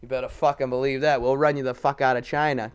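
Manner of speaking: normal talking voice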